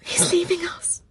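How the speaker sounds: high, slow, whispery tearful voice; breath-choked, heartbroken lament; sobbing. A narrator's reading. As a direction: squeeze the words out while crying. Narrator breaks down crying.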